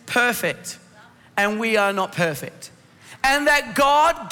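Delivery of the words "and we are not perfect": normal talking voice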